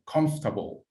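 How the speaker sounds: "Comfortable" said as three syllables, COMF-tuh-bul, slow and clear; teacher's dictation: "Comfortable" as COMF-ta-ble; slow model word. Shown as a demonstration. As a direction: model "Comfortable" for the class, kʰɑnf-tə-bəl, no middle vowel. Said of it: "I repeat".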